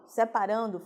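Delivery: normal talking voice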